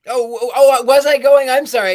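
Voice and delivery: startled and loud, rushing into an apology; flustered surprise. Real, not acted. Surprised to be called on, blurting loudly. Realizes it was her turn.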